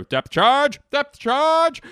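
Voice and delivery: very high, loud naval yell; hammed-up captain screaming an order; frantic movie impression. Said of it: "here's my impression of".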